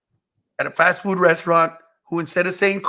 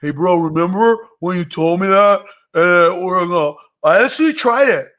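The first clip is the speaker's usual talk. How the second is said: imitating young comedian; 3 dB louder than usual. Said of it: "a month later, they'll go".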